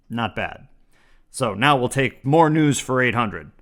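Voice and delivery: ordinary talk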